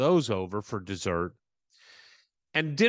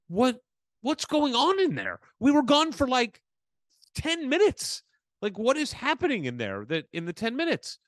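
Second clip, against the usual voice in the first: much higher, hammed-up clueless question; mock baffled; 11 semitones higher than usual. Asking the neighbor what happened in there, high mock baffled voice. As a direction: play it oblivious and puzzled.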